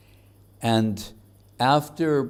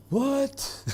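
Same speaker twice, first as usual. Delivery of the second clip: high, squeaky shocked what. Retold or imitated. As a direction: gasp it out in mock disbelief. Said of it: "And everyone goes".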